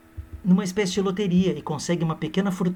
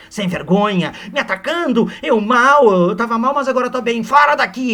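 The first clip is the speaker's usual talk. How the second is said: high, loud ranting; mock furious scolding; 7 semitones higher, 7 dB louder than usual. Aurélia berating Seixas, loud high hammed rage. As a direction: scold him like an outraged lady.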